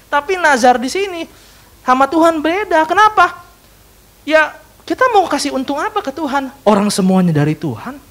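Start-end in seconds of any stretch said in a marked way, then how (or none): 0.1-6.5 preaching at full force. Acted. Preaching climax.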